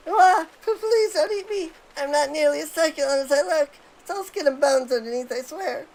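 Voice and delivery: high nervous sidekick begging for mercy; nervous Wedge. Acted. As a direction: plead not to be eaten, in a high panicky voice. Voicing Wedge's game lines.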